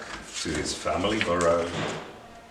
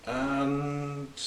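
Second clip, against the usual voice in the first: softer, higher hesitant and; trailing off stuck; 5 semitones higher, 6 dB quieter than usual. A narrator's reading. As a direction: let it fade out, lost for words. Rabbit stuck for a rhyme.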